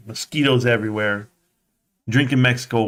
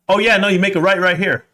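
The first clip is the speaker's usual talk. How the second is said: put-on high, louder excited boy; eager kid directions; 8 semitones higher, 4 dB louder, faster than usual. Boys in the car to the driver, excited. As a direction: point the way like a proud kid.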